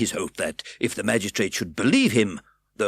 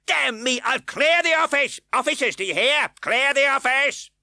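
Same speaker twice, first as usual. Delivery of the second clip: very high, loud furious shout; 7 dB louder than usual. A narrator's reading. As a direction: roar in frenzy. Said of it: "bursting out with the rage he had kept down".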